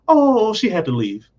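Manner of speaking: high, put-on innocent answer; breezy excuse. Wife answers husband about Geraldine, high breezy excuse. Voices wife's evasive answer.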